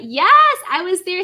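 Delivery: high, loud burst of delight; excited yes. Real, not acted. Streamer greeting a viewer, thrilled yes. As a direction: squeal it out to greet the chat.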